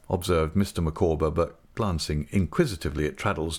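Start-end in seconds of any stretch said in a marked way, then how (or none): none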